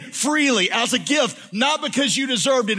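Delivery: preaching. Real, not acted. Preaching builds to a climax.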